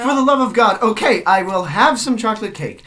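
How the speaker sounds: loud exasperated surrender; exasperated outburst. Acted. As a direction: cave in with a frustrated cry. Visitor gives in to landlady.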